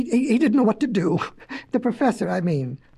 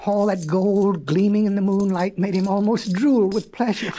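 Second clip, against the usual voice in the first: plain reading voice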